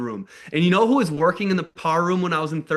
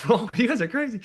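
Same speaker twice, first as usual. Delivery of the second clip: laughing; 5 semitones higher than usual. Real, not acted. Laughing at the clerk's reaction.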